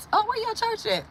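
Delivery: sudden high mock question; mocking a lying dude. Imitating a man lying about his church, high and mocking. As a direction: poke fun at a fake pastor's dodge.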